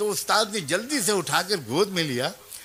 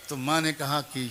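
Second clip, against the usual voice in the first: ordinary talk, nothing marked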